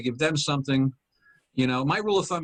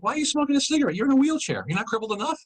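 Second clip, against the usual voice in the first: high-pitched, offended challenge; indignant inner rant; 11 semitones higher, faster than usual. Retold or imitated. Comic recounts scolding a smoker in his head, indignant. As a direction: demand it in high, affronted disbelief.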